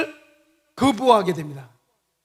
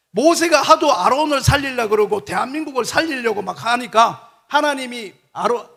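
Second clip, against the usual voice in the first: ordinary talk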